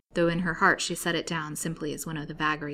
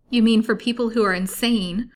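raised, loud, pressing question; alarmed insistence; 5 semitones higher, 9 dB louder than usual. A narrator's reading. Patient clutching the nurse's arm, alarmed. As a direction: press her for the dreadful truth.

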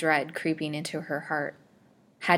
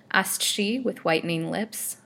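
ordinary delivery, nothing marked

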